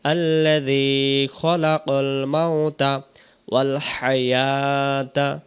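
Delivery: খলাকাল with the thick খ and ক, slow; correct reading. Shown as a demonstration. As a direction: recite খলাকাল with heavy, rounded letters. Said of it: "তাহলে আমরা বলবো". (খলাকাল মোটা খ আর ক দিয়ে ধীরে পড়া)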